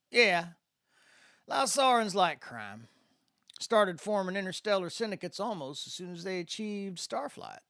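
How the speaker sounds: lightly voiced Mississippi accent, easygoing mentor; a hint of a folksy Mississippi spacer showing the kid around; Mississippi good old boy. A narrator's reading. Landon explains crime, Mississippi voice.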